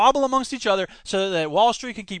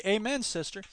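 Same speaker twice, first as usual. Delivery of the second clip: quiet amen; 8 dB quieter than usual. Shown as a demonstration. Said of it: "But that's actually".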